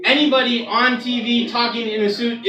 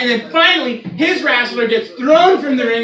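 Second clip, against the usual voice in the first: ordinary delivery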